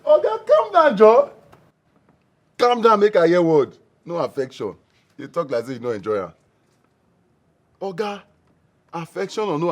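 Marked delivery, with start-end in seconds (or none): 0.1-3.8 agitated. Acted. Friend demanding the full story.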